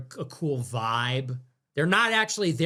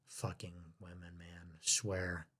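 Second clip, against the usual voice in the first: under the breath, much softer; muttered aside; 10 semitones lower, 16 dB quieter than usual. Real, not acted. Streamer grumbling about women, muttered under breath. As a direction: mutter it to yourself.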